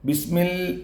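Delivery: normal talking voice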